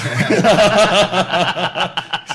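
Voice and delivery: long high laugh; hearty laugh. Real, not acted. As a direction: laugh at your own punchline. Laugh at his own punchline.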